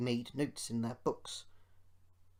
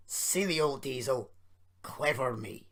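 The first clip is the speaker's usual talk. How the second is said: self-satisfied gloat; smug. A narrator's reading. Duncan gloating as he swaggers along, smug. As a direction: pat yourself on the back. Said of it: "he swaggered along".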